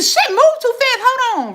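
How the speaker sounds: high, loud flustered complaint; flustered outburst. Real, not acted. Streamer losing track of the fast-moving chat, flustered. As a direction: exclaim as you lose your place, pitch jumping up.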